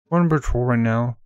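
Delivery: loud radio call; officer reporting over radio; police radio. Acted. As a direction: cop on the radio.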